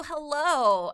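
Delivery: ordinary talk, nothing marked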